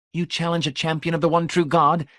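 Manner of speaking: high, disbelieving question; can't believe what he hears; incredulous. A narrator's reading.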